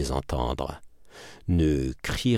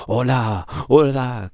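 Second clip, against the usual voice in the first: mild cry of pain; 4 semitones higher than usual. A narrator's reading. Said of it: "crie madame Lepic".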